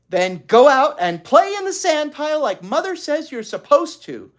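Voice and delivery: high, loud, firm order; bossy sister. A narrator's reading. Beezus orders Ramona out to the sand pile, bossy. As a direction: lay down the rule.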